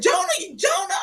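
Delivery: very high-pitched stricken calling; horrified cry. Retold or imitated. Friend repeats the dead son's name over the phone, horrified.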